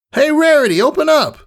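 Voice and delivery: high, brash and friendly; cocky praise from a hero; bold tomboy mare voice. Acted. Rainbow Dash praising Scootaloo after the fight, brash and friendly. Calling at door.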